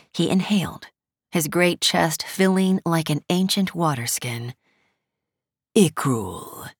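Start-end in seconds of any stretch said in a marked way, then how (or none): 5.8-6.7 slow, mild drawl, lower; lazy arrogant nobleman. A narrator's reading. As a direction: drawl the name lazily. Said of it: "he drawled, his tone mild".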